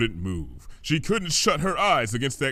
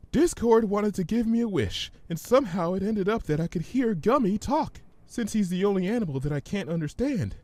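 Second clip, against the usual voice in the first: higher, speeding up; nervous Fluttershy; 9 semitones higher than usual. Acted. Fluttershy explains the wish to Twilight, higher and nervous. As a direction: explain with rising nerves.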